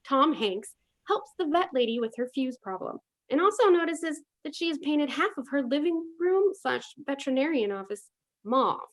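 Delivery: ordinary talk, nothing marked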